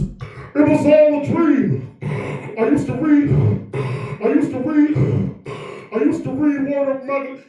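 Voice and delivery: hammed-up Biggie rap with a shout; [name] impression. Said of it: "like [name]".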